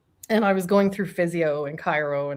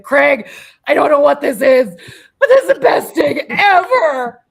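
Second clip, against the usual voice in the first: laughing exclaim; 8 semitones higher, 12 dB louder than usual. Retold or imitated. Retelling ecstatic steak moment to husband.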